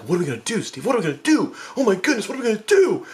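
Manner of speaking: escalating high-pitched frantic pleading; panicked. Retold or imitated. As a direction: build to a mock-panicked wail. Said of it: "completely stunned".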